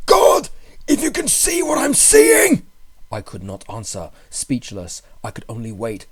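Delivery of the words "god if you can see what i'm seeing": high, loud, shaking with terror; terrified cry. A narrator's reading. Friend calling up from the vault, terrified. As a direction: cry out in horror from below. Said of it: "in a shaky whisper more portentous than the loudest shriek".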